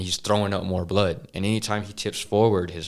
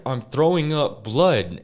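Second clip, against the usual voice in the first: pleading whine; 6 semitones higher, 6 dB louder, slower than usual. Retold or imitated. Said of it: "he's all like".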